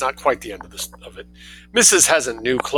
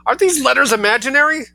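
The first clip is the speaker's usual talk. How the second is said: high, loud scream; 14 semitones higher, 5 dB louder than usual. Acted. Mother screaming at her son, high scream. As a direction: shriek it at the door. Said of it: "screamed Missus Heasant".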